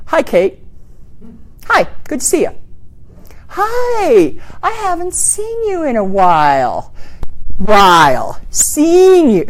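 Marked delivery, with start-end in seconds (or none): 1.7-6.9 long vowels on seen and while; sing-song welcoming hello; stretched vowels. Shown as a demonstration. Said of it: "See how I stretched out those vowels?". 7.6-9.5 While drawn long and falling; elongated vowels to sound friendly; stretched vowels. Shown as a demonstration. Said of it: "See how I stretched out those vowels?".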